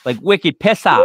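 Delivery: put-on broad Boston accent on "wicked pissa". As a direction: lay the Boston on thick. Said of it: "the most Boston accent you've ever heard".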